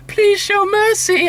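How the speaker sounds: high, slow begging plea; a hammed-up high plea for mercy; mock pleading. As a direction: ham up a desperate plea for mercy. Retold plea to Blood Queen.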